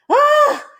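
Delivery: loud, high exasperated cry; sudden high outburst, put on; mock frustrated yell. Shown as a demonstration. Parent demonstrating reaction to spilled coffee, mock yell. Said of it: "I wanna yell".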